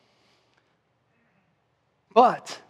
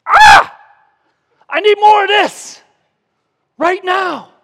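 put-on high, loud baby-like cry for more; mock hungry baby demanding; 15 dB louder, slower than usual. Preacher voicing the church as a hungry baby, loud high wail. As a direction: wail it out like a greedy newborn. Believers crying like hungry babies.

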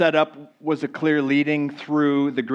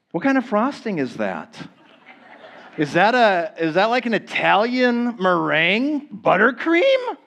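higher, tempted curiosity building; mock temptation; 6 semitones higher than usual. Preacher playing a dieter asking about the frosting, mock temptation. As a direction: get more excited as the frosting is identified.

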